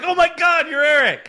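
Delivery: high, loud starstruck squeal; starstruck fan. Retold or imitated. Fan at a concert recognising the podcaster, squealing high and loud. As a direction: gush at him like you just met your hero. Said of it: "They're like".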